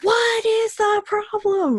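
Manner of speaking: imitating a mom shouting at screaming kids; mock yell. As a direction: bark it like a mom losing it. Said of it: "More screaming".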